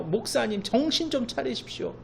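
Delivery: reproachful scolding, high then loud; exasperated critics. Retold or imitated. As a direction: people around scold the stubborn pastor. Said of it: "주변은요".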